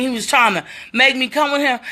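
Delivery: ordinary talk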